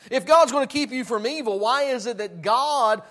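loud, high-pitched pulpit preaching; fiery preaching. Acted. Preacher to his congregation, fiery full-force preaching.

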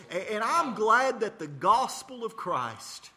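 slow, loud pulpit proclamation; preaching at full force. Acted. Radio preacher proclaiming to listeners, slow full-force preaching.

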